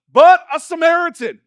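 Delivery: loud, high, punchy announcement; dropped like an F-bomb. Acted. Jesus shocking the church with a forbidden name, loud bombshell. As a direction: blurt it out like a swear in church. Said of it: "drop an F-bomb in church".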